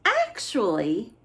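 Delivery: usual speaking voice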